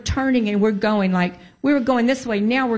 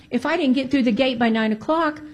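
ordinary talk, nothing marked

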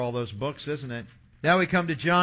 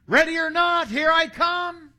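hide-and-seek call; 11 semitones higher, 5 dB louder than usual. Retold or imitated. Seeker calling to hiders in hide and seek, calling out. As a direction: sing it out across a cornfield. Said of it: "I was listening for someone to say".

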